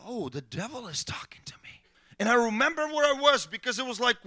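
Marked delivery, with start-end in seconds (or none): 0.0-1.8 hushed, scared whisper; freaked-out whisper. Retold or imitated. Pastor realizing the devil speaks, whispering. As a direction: breathe it in alarm. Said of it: "I freaked out".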